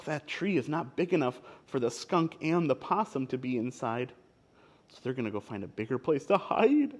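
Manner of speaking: ordinary delivery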